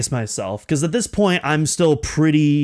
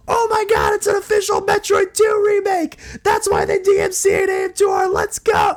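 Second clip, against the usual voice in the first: reenacted scream. Fan realizing the remake, ecstatic yell. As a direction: yell it in disbelieving joy. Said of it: "that's when I realized".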